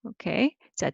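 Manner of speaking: ordinary talk, nothing marked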